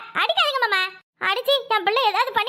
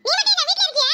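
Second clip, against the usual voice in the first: higher, mocking kid's voice; cheeky boy voice; 6 semitones higher than usual. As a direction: sass the grandfather like a bratty boy. Boy snaps back.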